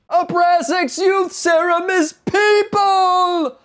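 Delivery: high, slow, dramatic movie yell; Soylent Green shout. Pretend. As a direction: howl it like a doomed hero. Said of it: "I made a Soylent Green reference".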